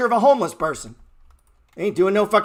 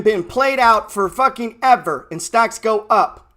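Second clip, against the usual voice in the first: ordinary talk, nothing marked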